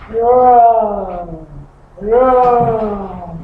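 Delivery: low, drawn-out mock roar; lion roar. Shown as a demonstration. Teacher imitating a lion for the class, mild low roar. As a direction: growl it out like a gentle lion. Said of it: "What is the sound you hear a lion?".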